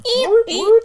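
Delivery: alarm siren woot woot. Shown as a demonstration. Alarm buzzer sound imitated.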